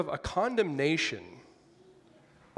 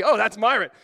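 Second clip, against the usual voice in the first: laughing mock recognition; 7 semitones higher, 11 dB louder than usual. Joke about resurrected with broken neck.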